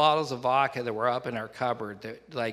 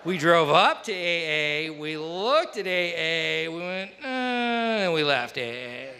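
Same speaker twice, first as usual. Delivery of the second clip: slow, stretched words in a higher pitch; drawn-out comic retelling; 4 semitones higher, slower than usual. Retold or imitated. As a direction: draw out each phrase like a slow drive-by. Comic retelling of drive-by avoidance.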